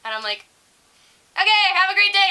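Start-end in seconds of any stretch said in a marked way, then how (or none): 1.3-2.3 high, loud cheery farewell; cheery send-off. Retold or imitated. Teller acting out her own send-off, cheery and high. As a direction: chirp it loudly, high-pitched.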